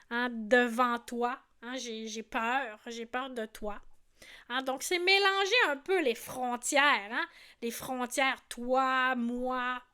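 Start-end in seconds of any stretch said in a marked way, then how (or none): none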